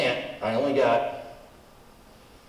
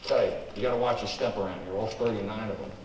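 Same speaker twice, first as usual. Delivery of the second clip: normal talking voice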